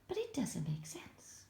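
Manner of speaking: soft puzzled whisper. Real, not acted. Teacher whispering to the class about the jumbled sentence. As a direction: hush it as a shared puzzle.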